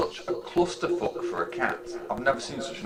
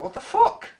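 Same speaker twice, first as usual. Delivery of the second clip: cat's voice; 5 dB louder than usual. Retold or imitated. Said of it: "It's like".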